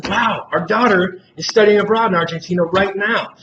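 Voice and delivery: a parent thrilled by a coincidence; excited parent. Retold or imitated. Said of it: "And that family says".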